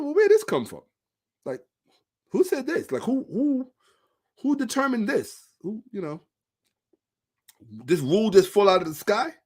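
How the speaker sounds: high, baffled self-quote; incredulous retold question. Scholar questioning the rule, baffled. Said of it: "I said".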